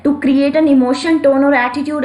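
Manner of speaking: reading a definition very slowly; slow dictation reading. Shown as a demonstration. Teacher reading the definition slowly for notes.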